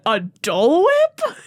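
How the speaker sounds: high, drawn-out puzzled echo; mock incredulous question. Child imitating Dad mishearing Jack, mock incredulous.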